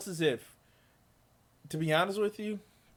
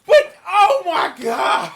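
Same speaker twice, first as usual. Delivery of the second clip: shocked shriek; 14 semitones higher, 14 dB louder, much slower than usual. Real, not acted. Shocked reaction to clip.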